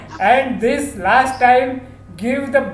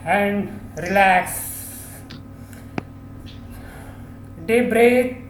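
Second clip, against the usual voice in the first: slow, soft, lower countdown voice; calm relaxation guide; 4 semitones lower, 10 dB quieter, much slower than usual. Acted. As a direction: ease the group into calm breathing.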